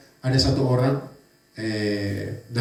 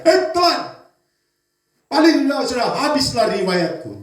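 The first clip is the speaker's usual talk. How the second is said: high, loud cry of despair, then slower; 9 semitones higher, 4 dB louder than usual. Acted. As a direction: cry out as if all is lost. Jonah swallowed by fish cries.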